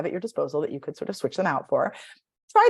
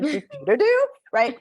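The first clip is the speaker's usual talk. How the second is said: higher, playful rising do; rising sing-song; 9 semitones higher, 5 dB louder than usual. Shown as a demonstration. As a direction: bounce the syllable up. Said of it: "up thing".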